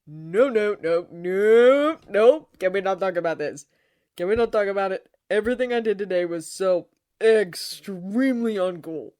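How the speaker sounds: loud, frantic, stretched-out refusals; mortified protest. Acted. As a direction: plead loudly to drop the embarrassing subject. Embarrassed Lucifer refuses to discuss today.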